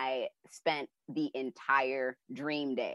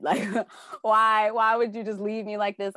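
high, louder anguished plea; upset child; 8 semitones higher, 7 dB louder than usual. Retold or imitated. Child mourning [name], high and louder anguished plea. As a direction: cry out at being left.

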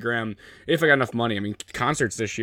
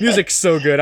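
louder and higher, laughing through it; laughing emphasis; 7 semitones higher, 8 dB louder than usual. Real, not acted. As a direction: lean into it with a laugh. Repeats it, laughing harder.

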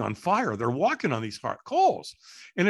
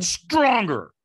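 louder, higher retold command; trainer's demand; 6 semitones higher, 6 dB louder than usual. Trainer urging a firewalker to say yes harder, demanding. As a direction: push them for more force. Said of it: "And he went".

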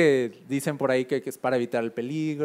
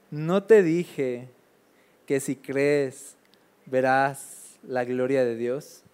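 slow solemn Jesus; 2 semitones lower, much slower than usual. Acted. Jesus quoted in Bible story.

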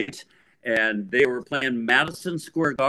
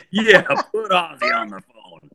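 mock stern; 11 semitones higher, 3 dB louder than usual. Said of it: "In a very stern voice".

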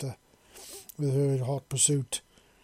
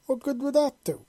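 much higher, louder squeaky question; high-pitched; 12 semitones higher, 5 dB louder than usual. Acted. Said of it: "Spike asked in a rather high-pitched voice".

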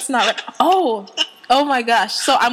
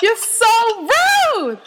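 high, drawn-out playful protest; exasperated, amused high complaint; mock outrage; 10 semitones higher, much slower than usual. Real, not acted. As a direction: whine it slowly, pitched high. Host protests sister's clowning.

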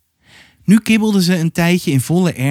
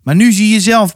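furious appeal; 7 semitones higher, 5 dB louder than usual. Acted. Repeated appeal, now furious.

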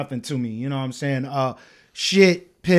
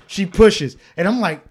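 ordinary delivery

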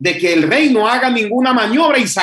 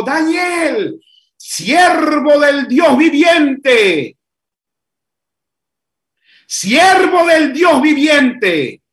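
slow, drawn-out shout, words stretched; anguished slow cry; 5 semitones higher, 3 dB louder, much slower than usual. Acted. King shouting down into the lions' den, slow anguished cry. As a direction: cry it out slowly toward the pit below.

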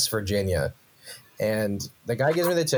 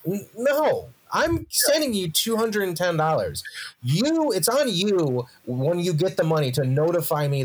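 higher, exasperated pushback at the clerk; indignant retort; 6 semitones higher than usual. Retold or imitated. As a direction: argue back like you're fed up with the bureaucracy. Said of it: "I'm like".

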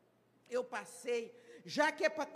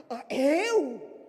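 much louder gasp of surprise; startled "me?"; 11 dB louder than usual. Retold or imitated. Member asked to lead the prayer, startled. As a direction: caught off guard, amazed.

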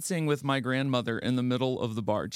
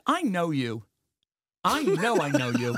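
higher-pitched, insistent mock recognition; retold Aunt Snooki; 3 semitones higher, 3 dB louder than usual. Aunt addressing a celebrity she thinks she knows, acted insistence. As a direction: act out an aunt sure she knows him. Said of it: "she looks at Jimmy and she goes".

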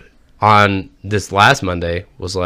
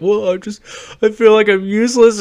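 high-pitched mock crying; imitated tearful wife; 10 semitones higher than usual. Sick wife confessing to her husband, mock tearful. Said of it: "she's, like, crying and shit at me".

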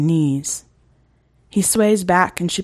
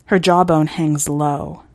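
ordinary delivery

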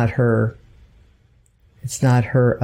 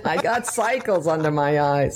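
lighter Brooklyn lion line; Brooklyn accent; 4 semitones higher, 3 dB quieter than usual. Retold or imitated. Mother repeating the lion's line to explain it, in a lighter Brooklyn voice. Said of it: "He said".

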